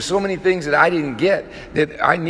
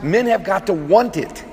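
ordinary delivery

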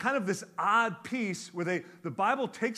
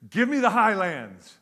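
bold demand; 5 semitones higher, 9 dB louder than usual. Retold or imitated. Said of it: "he says".

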